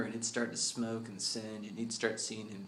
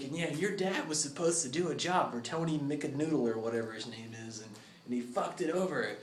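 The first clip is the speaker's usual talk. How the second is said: higher, louder mocking villain; taunting villain; 6 semitones higher, 4 dB louder than usual. Crime Face telling the kid his dad was killed, a taunting gloat. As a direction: gloat over the kid's ruin. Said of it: "he's like".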